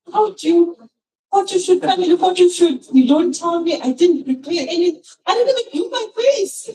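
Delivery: high-pitched, incredulous retold complaint; indignant protest. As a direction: replay the outrage at a sprung photo shoot. Retells her indignant protest to him.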